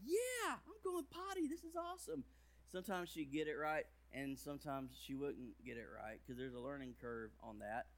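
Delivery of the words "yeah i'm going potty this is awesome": put-on high little-girl voice, thrilled; toddler voice. Little daughter proudly announcing she is going potty, high toddler voice. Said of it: "she'd be like".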